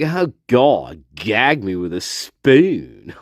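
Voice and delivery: put-on slow, low valley girl drawl; a light valley girl gag. Mocking his sister with a slow valley girl groan.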